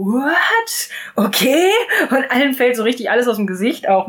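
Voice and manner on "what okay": high, startled cry then a flat okay; stunned family, mock shock; shocked what. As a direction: everyone gapes at the news. Everyone shocked at Edith invite.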